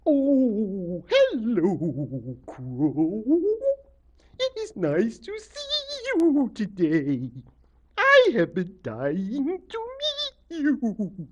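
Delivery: drawn-out, loud, swooping octopus voice; octopus lady. A narrator's reading. The head greets Crow on meeting him, as a drawling octopus lady. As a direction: stretch the words out in a big, gliding creature voice. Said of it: "the head said".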